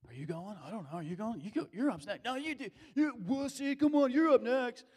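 rising to a loud, high taunt; mock egging on. Disciples daring each other, building to a loud taunting shout.

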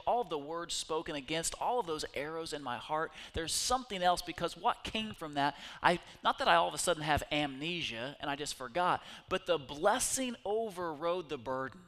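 normal talking voice

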